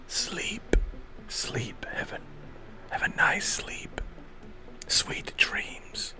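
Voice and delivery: soft unvoiced whisper, soothing sleep; whispered lullaby. Lulling listener to sleep.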